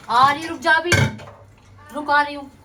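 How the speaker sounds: loud call to wait. Real, not acted. Mother calling out loud to her daughter to wait. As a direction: call out across the house to hold on.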